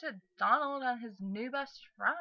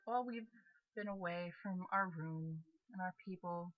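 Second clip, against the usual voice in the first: ordinary talk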